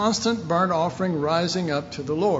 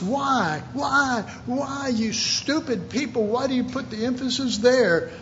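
raised, higher pitch, pressing the question; exasperated why; 7 semitones higher than usual. Real, not acted. Preacher berating his listeners, raised and exasperated. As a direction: demand it like the answer should be obvious. Preacher scolds congregation, rhetorical.

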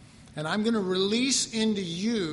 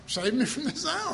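higher, pleading mock of our prayer; whiny mock prayer; 7 semitones higher than usual. Preacher mocking how we pray, pleading and high. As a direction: beg it like a half-hearted believer. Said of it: "Just like".

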